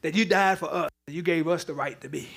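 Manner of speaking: quiet, reverent closing prayer. Acted. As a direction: pray it softly to the Father.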